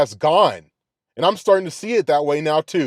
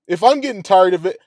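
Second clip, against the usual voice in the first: normal talking voice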